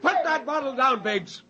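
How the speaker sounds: high, sharp order to stop; stern command. Acted. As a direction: warn him off firmly. Spender stops Biggs, angry.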